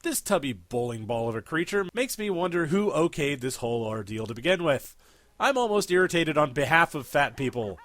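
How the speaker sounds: usual speaking voice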